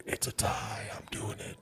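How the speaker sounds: very soft, voiceless whisper; hushed secret aside. Acted. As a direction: whisper it under your breath. Whispered aside in the horse bit.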